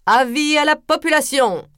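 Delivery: high, projected town-crier call; lightly voiced herald calling out; herald's proclamation. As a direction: announce it to the square. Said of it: "déclara-t-il".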